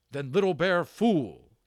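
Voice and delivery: loud, bitter outburst; bitter cry from the dark. A narrator's reading. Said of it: "came his bitter voice out of the dark".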